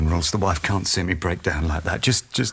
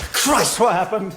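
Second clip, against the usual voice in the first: shocked, raised high; alarmed; 4 dB louder than usual. Acted. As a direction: burst out in shock. Finding her hurt.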